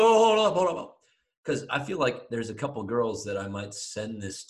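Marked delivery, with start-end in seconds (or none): 0.0-1.0 sudden loud, high "hold up"; excited. Real, not acted. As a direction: jump in loudly to stop them.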